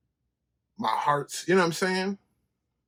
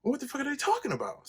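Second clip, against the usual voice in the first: baffled; 13 semitones higher than usual. Retold or imitated. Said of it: "I'm like".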